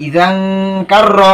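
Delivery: ইজান with a long nasal "ng" hum before কার র; hidden n, ghunnah. Shown as a demonstration. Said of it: "গুণনা করে পড়তে হবে".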